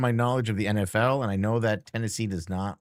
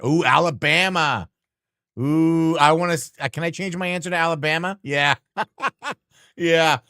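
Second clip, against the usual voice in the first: louder, higher eager guess; excited realization; 6 semitones higher, 7 dB louder than usual. Real, not acted. Streamer to chat, excited eager guess. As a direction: jump at the new answer.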